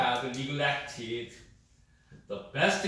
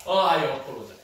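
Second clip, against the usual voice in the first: louder, heartfelt oh, I apologize; strong apology; 9 dB louder, faster than usual. Shown as a demonstration. Teacher shows apologize as a strong feeling, louder. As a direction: open on a heavy oh, deeply sorry. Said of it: "strong feeling".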